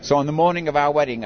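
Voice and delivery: normal talking voice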